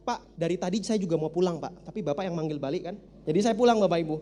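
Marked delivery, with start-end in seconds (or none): 0.1-3.0 cheeky nonchalant. Retold or imitated. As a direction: complain lazily, like a bored kid. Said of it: "Saya bilang".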